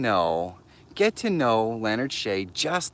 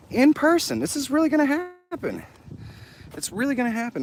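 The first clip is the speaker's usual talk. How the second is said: much higher, squealing with delight; giddy excitement; 12 semitones higher than usual. Real, not acted. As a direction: gush like a thrilled fan. Thrilled about meeting a creator.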